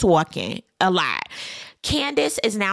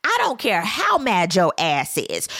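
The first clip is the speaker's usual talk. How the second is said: ordinary talk, nothing marked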